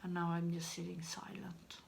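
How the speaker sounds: whispered awkward aside; awkward whispered remark. Real, not acted. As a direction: whisper it into the silence. Awkward ending of video.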